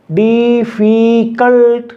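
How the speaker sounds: डिफिकल्ट in three long, slow syllables, डी-फी-कल्ट. Shown as a demonstration. Teacher repeats for the vowel count, डिफिकल्ट said syllable by syllable. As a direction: sound out डिफिकल्ट slowly, holding each vowel. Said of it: "vowel three".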